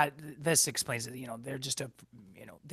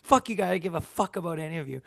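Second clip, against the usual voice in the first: retold snapping; 3 semitones higher, 4 dB louder, faster than usual. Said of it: "I just ended up snapping".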